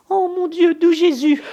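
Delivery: very high, loud terrified wail; panicked cry. Acted. Old servant cries out in fright at the threat. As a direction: shriek to heaven in panic.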